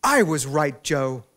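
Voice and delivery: loud excited yell across a room; triumphant mock scream; retold scream. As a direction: shout it across the restaurant to her husband. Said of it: "screams across the restaurant".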